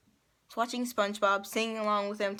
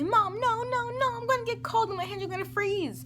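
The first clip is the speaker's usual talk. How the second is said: much higher, louder, whiny begging; panicked pleading; 13 semitones higher, 4 dB louder than usual. Retold or imitated. Daughter begs mom not to pull her hands out, high panicked whine. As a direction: plead like a scared little kid about to lose her warm water.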